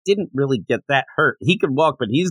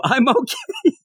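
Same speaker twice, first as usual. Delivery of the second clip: dazed; 10 semitones higher, slower than usual. Retold or imitated. Concussed Zenitsu dazed reply.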